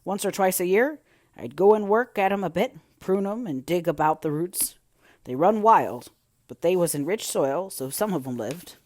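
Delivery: loud country gardener voice; hearty dialect, hit hard; Ben Weatherstaff. A narrator's reading. Old gardener explaining roses to a child, loud rustic voice.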